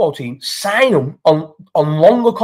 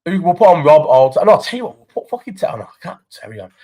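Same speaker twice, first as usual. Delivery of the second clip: ordinary talk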